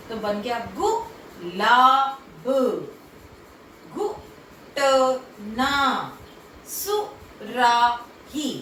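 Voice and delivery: घुटना and सुराही drawn out slowly, syllable by syllable; slow teaching demo. Shown as a demonstration. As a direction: spell out each word slowly for the class. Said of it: "इस तरह से आपको". (कक्षा के लिए हर शब्द धीरे-धीरे खींचकर)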